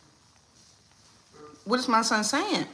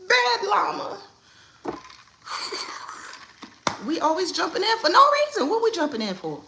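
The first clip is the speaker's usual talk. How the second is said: mock scolding; 10 semitones higher, 4 dB louder than usual.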